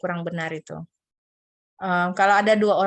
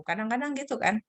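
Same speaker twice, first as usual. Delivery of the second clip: ordinary talk, nothing marked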